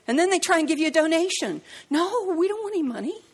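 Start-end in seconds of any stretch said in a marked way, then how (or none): 1.9-3.2 high, bright-pitched polite no; retold cheerful refusal; mock polite refusal. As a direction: turn the money down sweetly.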